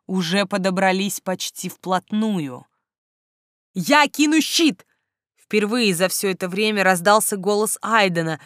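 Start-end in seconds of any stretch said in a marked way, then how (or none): 3.7-4.9 higher, slower call. A narrator's reading. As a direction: call out to reassure her. Aiden calls out in battle.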